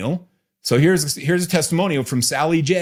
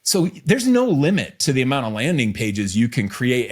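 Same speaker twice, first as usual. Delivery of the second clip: ordinary talk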